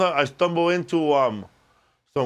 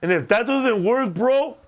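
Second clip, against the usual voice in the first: put-on higher, eager young bro voice; J-Rod voice; 3 semitones higher than usual. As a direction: hype it up like an Instagram electrician kid. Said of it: "it's a kid on Instagram named J-Rod".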